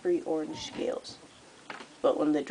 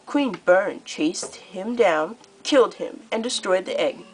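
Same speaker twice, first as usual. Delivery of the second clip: gloating queen; 3 semitones higher, 6 dB louder than usual. Acted. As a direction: savour the kill like good news. Scarlet boasting of the killing.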